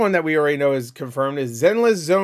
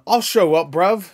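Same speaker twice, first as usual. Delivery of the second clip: put-on light, louder London British accent; 5 semitones higher, 6 dB louder, slower than usual. Idris Elba announcing he'll show up, British accent. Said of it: "'Cause he's British".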